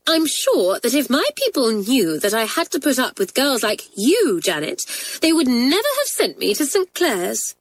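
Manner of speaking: haughty, high-pitched indignation. A narrator's reading. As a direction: lift the pitch high in affronted protest. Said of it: "Sheila raised her voice and addressed Janet".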